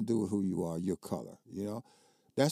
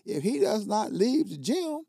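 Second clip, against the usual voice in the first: retold angry protest; 12 semitones higher, 4 dB louder than usual. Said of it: "I said".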